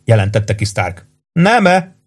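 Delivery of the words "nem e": high, insinuating challenge; sly. A narrator's reading. Warden lures Stark toward a bet, slyly. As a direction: bait him craftily. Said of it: "kérdezte alattomosan Warden".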